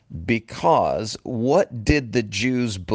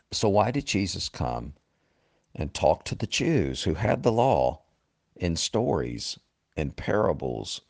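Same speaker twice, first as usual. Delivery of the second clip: usual speaking voice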